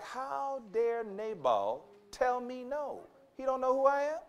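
retelling David's fury with a hammed-up indignant tone; angry David. Preacher voicing David offended by Nabal, slow mock outrage. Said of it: "That brother is angry".

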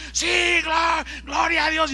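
high, loud praise cheer; crowd shouting. Retold or imitated. Stadium crowd answering the organizer's boast, high shouting. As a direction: cry out like fans in a frenzy. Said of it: "empezaron a gritar".

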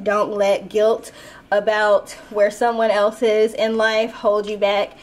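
ordinary talk, nothing marked

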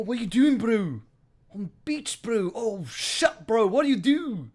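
put-on high, loud New Zealand accent. Streamer quotes Beached Whale video to chat, high New Zealand accent. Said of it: "Can I do a New Zealand accent?".

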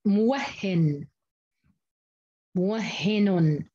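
موهن drawn out very slowly as mu-wah-hi-nun, waw open and ha doubled; a teacher slowly sounding out موهن for students; reading with open waw. Shown as a demonstration. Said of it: "فتح الواو معناته موهن".

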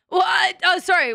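loud, high startled yelp; loud surprised what; startled shout. Real, not acted. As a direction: jump and yelp at a scare. Startled outburst.

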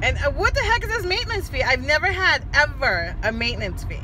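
high, loud outraged question; furious. Real, not acted. Mom driving to work complaining about bank fees, real furious rant. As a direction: vent your outrage at the bank. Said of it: "I'm so furious".

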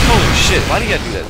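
startled shout. Real, not acted. Gamer caught off guard by an enemy, startled shout. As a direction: jump at the sudden attack.

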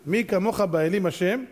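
ordinary talk, nothing marked

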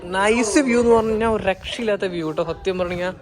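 loud, high, thrilled touring; excited. Real, not acted. Vlogger touring the resort for viewers, thrilled and loud. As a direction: share the thrill of the place. Excited tour and calling child.

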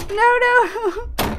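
high, loud yelp; panicked cry. Real, not acted. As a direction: cry out as you're caught. Monster catches player.

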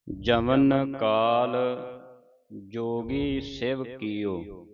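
slow, drawn-out measured chant of the line; scripture recitation. Shown as a demonstration. Teacher reciting a verse for students to follow, slow measured chant. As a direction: recite it slowly, holding the long vowels.

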